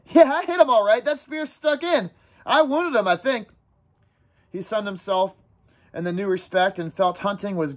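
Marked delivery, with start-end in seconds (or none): 0.1-3.5 put-on high, eager boyish boasting; talking on excitedly. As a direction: tumble on proudly about the hit. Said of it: "Ralph talked on excitedly".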